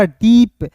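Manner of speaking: "डीप" with the ee stretched long; long drawn-out ee. Shown as a demonstration. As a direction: draw out the vowel in "डीप". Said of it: "थोड़ा सा खींच कर पढ़ते हैं".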